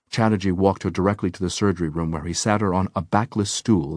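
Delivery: plain reading voice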